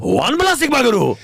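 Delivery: high hammed-up Japanese accent; mock Japanese accent. Teasing a friend, mock Japanese accent. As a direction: blurt it out again like a cartoon.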